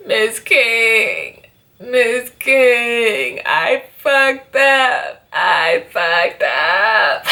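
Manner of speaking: drawn-out, slow mock whine; hammed-up whimpering boyfriend voice; whiny boyfriend. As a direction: imitate him whining his apology slowly. Boyfriend's whiny confession retold.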